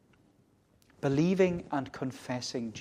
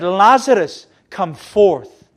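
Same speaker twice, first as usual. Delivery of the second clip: loud ringing command to the dead, much louder and higher; commanding call; 5 semitones higher, 16 dB louder, slower than usual. Acted. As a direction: call him out of the tomb with full authority. Jesus calling Lazarus from grave.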